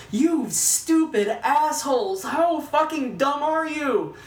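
high, slow, drawn-out outrage; incredulous fury. Retold or imitated. Berating the filmmakers over the grave scene, incredulous fury. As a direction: stretch each word in exasperated protest.

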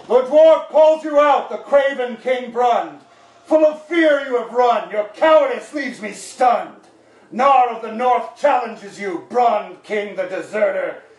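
slow, loud, booming challenge. Acted. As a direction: boom it out like a dwarf warrior. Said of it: "in a loud and booming voice".

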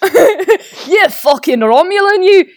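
high, drawn-out Scottish insult; aggressive Glaswegian. Acted. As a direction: play a sweary Glaswegian engineer, hammed up. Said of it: "much more Glaswegian".

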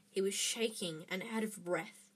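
ordinary delivery, nothing marked

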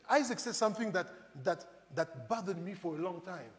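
ordinary delivery

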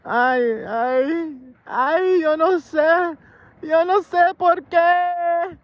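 high, slow, exasperated mother voice; mom's voice. Retold or imitated. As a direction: play the flustered Spanish mom wailing. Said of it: "She said".

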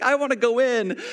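high imitated voice of a frightened child; scared daughter. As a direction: plead to leave, near tears, lightly acted. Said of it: "scared now".

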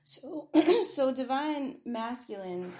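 clears throat mid-sentence; quick throat clear before speaking. Real, not acted. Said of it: "excuse me".

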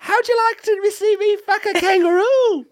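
very high squeaky Aussie; mock Australian accent. Co-host joining the impression of the listener, squeaky mock Australian. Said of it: "that's what this guy sounds like".

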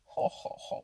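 a loud mocking ho ho ho; mocking laugh. Otter mocks the muskrat's weather talk, laughing. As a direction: laugh at Jerry like he's being silly.

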